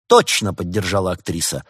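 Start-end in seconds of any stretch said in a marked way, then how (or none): 0.1-0.5 loud, raised-pitch support; second it firmly and loudly; keen endorsement; emphatic agreement. A narrator's reading. Actress backs the motion.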